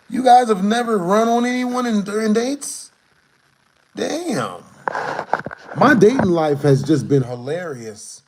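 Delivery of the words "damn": usual speaking voice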